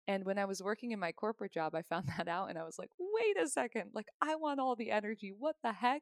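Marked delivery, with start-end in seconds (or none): 3.0-6.0 startled objection, pitched high; high-pitched, playful disbelief; mock indignant protest. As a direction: act out a taken-aback complaint. Acts out her indignant first reaction.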